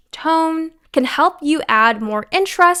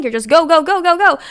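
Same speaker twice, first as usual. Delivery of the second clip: hurried, louder and higher, no breath; rushed go go go; 5 semitones higher, 5 dB louder, faster than usual. Shown as a demonstration. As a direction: rattle it off without pausing. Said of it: "When you speak like that".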